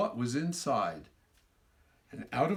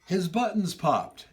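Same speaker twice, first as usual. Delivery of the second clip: excited storytelling; 10 semitones higher, 6 dB louder, slower than usual. A narrator's reading. Climax of funny story.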